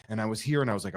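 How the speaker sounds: ordinary talk, nothing marked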